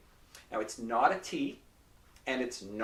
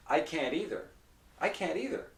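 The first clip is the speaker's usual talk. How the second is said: quick, linked everyday delivery; normal fast speech; 5 semitones higher than usual. Shown as a demonstration. Teacher showing normal speech to students, fast. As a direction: run it together at native speed. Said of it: "fast speech".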